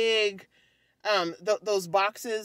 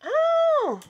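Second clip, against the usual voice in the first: surprised gasp; 3 dB louder than usual. Real, not acted. Surprised by glowing toy.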